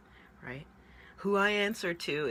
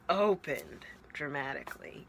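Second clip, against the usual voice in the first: usual speaking voice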